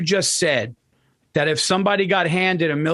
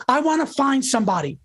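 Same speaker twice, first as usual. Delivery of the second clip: higher, heated rant voice; ranting quote; 8 semitones higher than usual. Retold or imitated. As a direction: build the rage of a tax rant. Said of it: "And he goes".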